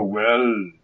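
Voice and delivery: slow, measured Agent Smith drawl; imitating Agent Smith greeting Starscream; Agent Smith impression. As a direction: deliver it like a cold program agent. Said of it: "Agent Smith basically just go".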